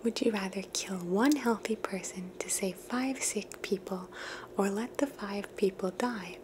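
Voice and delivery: normal talking voice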